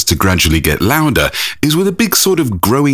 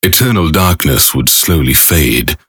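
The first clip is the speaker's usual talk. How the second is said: epic narration; 7 semitones lower than usual. Acted. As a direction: intone it like a film trailer.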